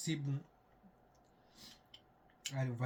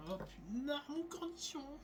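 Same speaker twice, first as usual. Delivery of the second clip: surprised squeal; 13 semitones higher, 5 dB quieter than usual. Real, not acted. Exclaims over his pickle.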